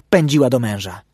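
ordinary delivery

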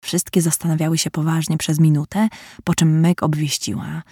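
ordinary delivery, nothing marked

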